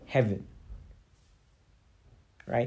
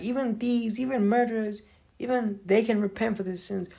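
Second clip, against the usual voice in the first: mocking preachy voice; 8 semitones higher, 5 dB quieter than usual. Mocking pious people's preaching.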